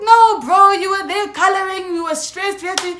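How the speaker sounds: high-pitched, urgent protest; mock outcry of defensive friends; imitating worked-up friends. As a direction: burst out high and loud, then ease off. Acting out friends explaining their arrival.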